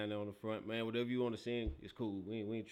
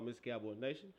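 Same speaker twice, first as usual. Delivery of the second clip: ordinary talk, nothing marked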